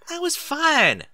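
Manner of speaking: put-on high, loud child's grumble; sulky little sister insisting; a hint of a grumbling child; grumbling filly. A narrator's reading. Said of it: "Applebloom grumbled".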